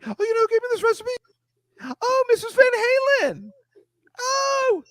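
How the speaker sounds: very high, fast breathy gushing fan; gushing falsetto. Pretend.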